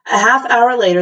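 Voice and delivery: ordinary delivery, nothing marked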